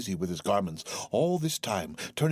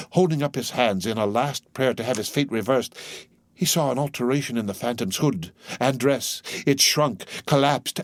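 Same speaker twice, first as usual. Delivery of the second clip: ordinary delivery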